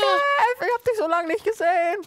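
high tearful voice; mock crying. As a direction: sob out the longing theatrically.

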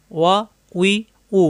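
ওয়া, উই, উ one by one with pauses; model for repeating. Shown as a demonstration. Teacher inviting students to recite along, separated syllables. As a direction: sound each syllable separately for others to follow. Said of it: "আমার সাথে সাথে উচ্চারণ করুন".

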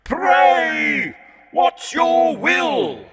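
high, slow, booming devil's greeting; a mocking devil at hell's gate; Satan's voice. A narrator's reading. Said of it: "till Satan came".

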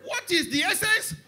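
high, loud protesting outburst; angry preaching. Real, not acted. Preacher protesting delayed blessings to God, angry outburst. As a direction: cry out in loud complaint to God. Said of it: "do you know why I'm angry".

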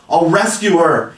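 high, loud resolve; cried out. A narrator's reading. Peter leaping at his weapons, crying out. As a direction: cry it as a rallying vow. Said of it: "he cried".

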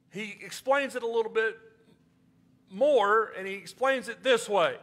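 usual speaking voice

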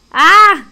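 a sudden loud startled cry; startled yelp. Real, not acted. Streamer startled by the game's sudden start, yelping loudly. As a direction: jump and yelp as the game springs on you.

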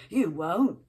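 loud angry snap; snapped. A narrator's reading. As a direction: bite the words off in a fight.

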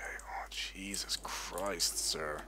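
drawn-out breathy whisper of disbelief; slow awed whisper. Real, not acted. Player gasps at the damage he did, slow hushed whisper. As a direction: whisper it slowly, stunned. Reacting to his huge hit.

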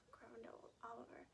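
plain reading voice

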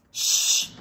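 a loud hushing shh. Pretend. Storyteller hushing children so the sleeping tiger stays asleep. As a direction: shush them so the tiger sleeps.

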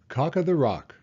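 usual speaking voice

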